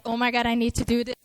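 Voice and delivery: ordinary talk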